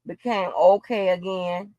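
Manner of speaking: ordinary delivery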